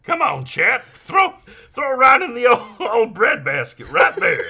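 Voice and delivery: very high, loud coaxing; hammed-up high catcher voice; John C. Reilly voice. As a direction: plead like a goofy catcher. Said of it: "then I did the voice".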